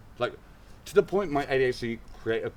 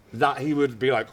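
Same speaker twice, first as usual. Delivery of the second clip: normal talking voice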